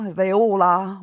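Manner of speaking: ordinary delivery, nothing marked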